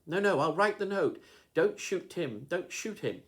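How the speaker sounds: high, loud desperate pleading; girl begging them not to shoot her dog; panicked scream. A narrator's reading. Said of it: "gave a scream".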